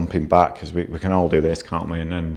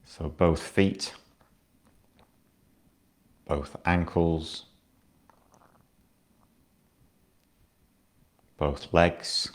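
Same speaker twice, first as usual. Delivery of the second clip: guided meditation. Acted. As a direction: ease the listener down into stillness. Guides a body scan.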